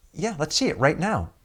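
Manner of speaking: high insistent mock producer; imitating the producer. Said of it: "She goes".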